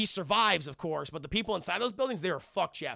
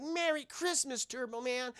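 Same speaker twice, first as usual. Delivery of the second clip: mock child voice; 5 semitones higher, 5 dB quieter than usual. Retelling boy's reply to hero.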